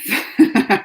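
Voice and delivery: real burst of laughter; amused laugh. Real, not acted. Streamer reacting to a comment about Trump, amused laugh. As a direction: laugh at a viewer's joke.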